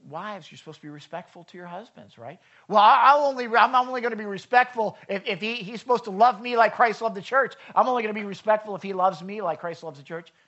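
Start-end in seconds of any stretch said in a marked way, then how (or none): 2.7-10.3 higher, louder whiny wife, hammed-up; a mock wife insisting on her conditions; mock stubborn wife. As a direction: play the wife laying down her terms. Wife's objection voiced.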